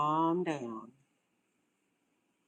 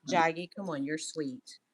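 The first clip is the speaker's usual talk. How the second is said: ordinary talk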